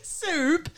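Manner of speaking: Soup said high with a rising, stretched Cockney vowel; high Cockney-style Soup; imitating Cockney soup. Shown as a demonstration. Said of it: "It's soup".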